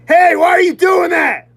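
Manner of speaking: screamed, high and very loud; furious shout. Retold or imitated. Angry at smirking strangers, an all-out furious scream. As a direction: scream it at them in rage. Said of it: "I just get really fucking angry".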